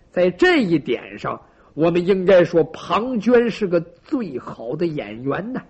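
plain reading voice

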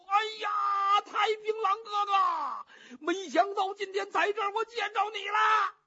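high, loud wailing cry of reunion. Acted. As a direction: sob it out loud, voice climbing high. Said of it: "咧着嘴就哭". (咧着嘴大哭着喊)

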